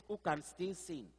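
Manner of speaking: normal talking voice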